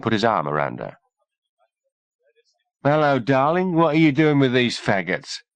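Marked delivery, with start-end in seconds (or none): 2.8-5.4 put-on raised, higher slurred drunk soldier; loud, slurred drunk. A narrator's reading. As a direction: play the pushy drunk at the bar. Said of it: "he said in a loud, slurred voice".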